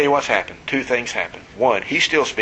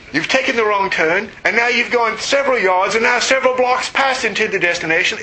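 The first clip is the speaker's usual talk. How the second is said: scolding GPS; 8 semitones higher than usual. Pretend.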